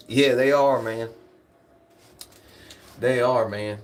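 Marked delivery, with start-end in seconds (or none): none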